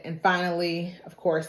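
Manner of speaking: ordinary talk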